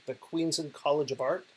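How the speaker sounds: normal talking voice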